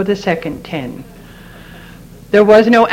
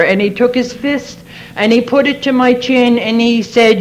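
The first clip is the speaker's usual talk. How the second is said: normal talking voice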